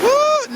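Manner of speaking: a high, loud woo that echoes off the stream; excited whoop. Real, not acted. Vlogger by the stream, cutting loose with a whoop. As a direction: let out a big happy whoop. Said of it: "Now I can talk in my Philly voice".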